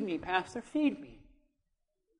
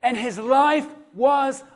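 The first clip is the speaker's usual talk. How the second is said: loud, punchy sermon delivery building up; fiery preaching; 5 semitones higher, 10 dB louder than usual. Acted. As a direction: preach it hard to the congregation. Preaching at full force.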